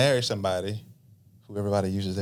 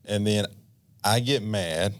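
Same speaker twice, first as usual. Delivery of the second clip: ordinary talk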